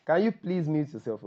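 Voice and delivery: ordinary delivery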